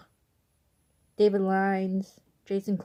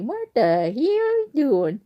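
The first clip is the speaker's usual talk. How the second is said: higher, louder and slow, a mock exasperated dad; imitating dad; 5 semitones higher, 5 dB louder than usual. Dad catching his daughter biting herself, mock exasperated. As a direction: ham up a baffled dad catching you. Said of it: "My dad was like".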